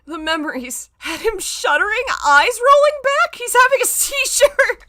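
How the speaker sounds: very high, loud squealing through laughs; hysterical laughter. Real, not acted. Reading the shuddering line, shrieking with laughter. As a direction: barely get the words out for laughing.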